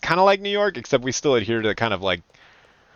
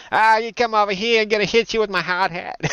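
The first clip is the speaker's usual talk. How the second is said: put-on higher, brash New England accent threat; 9 semitones higher than usual. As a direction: sound like a rough New England dockworker. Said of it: "Bunch of, uh, Boston, well, not Boston, but New Englanders".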